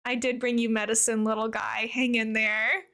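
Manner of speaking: ordinary delivery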